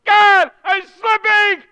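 high, loud, slow cry to God; desperate shout. Acted. Psalmist screaming to God for help, desperate shout. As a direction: cry out as if falling. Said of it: "with an exclamation point".

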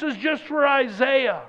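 fervent preacher in full swing; preaching at full force. Acted. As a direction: drive the congregation toward awe.

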